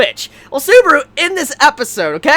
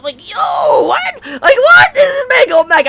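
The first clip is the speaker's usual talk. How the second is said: much higher, cracking shout of shock; shocked disbelief; 12 semitones higher, 4 dB louder than usual. Real, not acted. Fan realizing Subaru is going back, shrieking in shock. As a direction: scream it like your heart just dropped.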